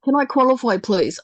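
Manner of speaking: usual speaking voice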